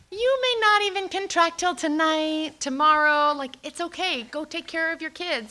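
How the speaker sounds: high, singsong soothing voice; reassuring midwife. Retold or imitated. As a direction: coo it like an unworried midwife. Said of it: "and she says".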